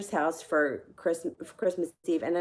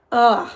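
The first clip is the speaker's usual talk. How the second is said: loud, higher-pitched ugh; exasperated groan; 4 semitones higher, 11 dB louder than usual. Real, not acted. Storyteller fed up with the party story, exasperated groan.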